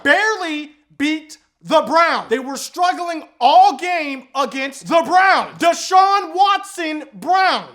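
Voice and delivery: slow, loud, high "Barely beat the Browns."; slow loud emphasis. Real, not acted. Mocking the Eagles' close wins, slow and loud. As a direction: drag it out loud in disbelief. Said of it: "And then they barely beat the Browns".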